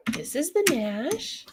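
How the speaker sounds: high, excited come-here voice; calling the puppy. Real, not acted. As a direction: coax the dog over to the camera. Introducing dog to viewers.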